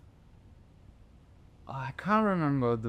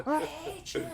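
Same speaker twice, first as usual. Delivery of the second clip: high, childish mock yell laughing through it; a hint of a screaming boy; 12 semitones higher, 6 dB quieter than usual. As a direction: imitate a kid screaming, with a laugh. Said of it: "he was screaming".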